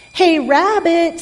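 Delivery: loud, high, drawn-out holler; yelled call. A narrator's reading. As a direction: call out to someone inside the house. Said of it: "someone yelled outside".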